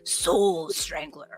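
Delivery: slow, drawn-out announcer boom; monster truck ad. Shown as a demonstration. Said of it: "say it like you're a monster truck announcer".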